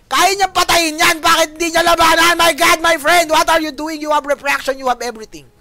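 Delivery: high, loud shout easing off towards the end; exasperated play-by-play. Real, not acted. Caster asking a player "what are you doing", exasperated shouting. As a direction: cry out in disbelief at the player.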